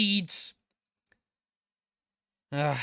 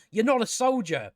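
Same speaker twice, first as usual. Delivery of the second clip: ordinary talk, nothing marked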